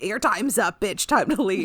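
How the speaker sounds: loud, brash order to leave; retold angry outburst, played for laughs; curt mock dismissal. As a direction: throw someone out loudly, half joking. Acted hostile dismissal in retelling.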